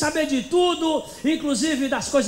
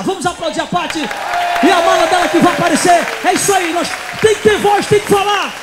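loud cheering call, higher pitched; crowd-rousing shout; 4 semitones higher, 9 dB louder than usual. Real, not acted. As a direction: fire up the audience, full voice. Leading applause for Pati.

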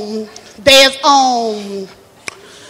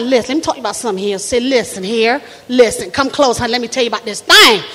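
no-nonsense Southern woman, low; low, confiding Southern elder; Miss Doreen; 4 semitones lower, 4 dB quieter than usual. Acted. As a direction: draw them close in a low Southern voice. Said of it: "from the South, and she don't take no nonsense".

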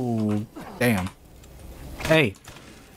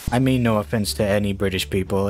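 ordinary delivery

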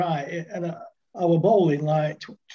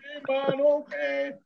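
put-on relaxed Jamaican accent, pitched higher; easygoing Jamaican accent, shrug it off; 8 semitones higher, slower than usual. Jamaican fast bowler answering the batsman, laid-back Jamaican accent. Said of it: "Jamaican, cool dude".

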